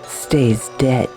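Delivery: normal talking voice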